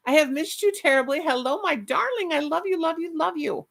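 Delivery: cooing pet names up high; sweet high cooing to a beloved viewer; high affectionate coo. Real, not acted. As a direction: coo lovingly at a dear friend.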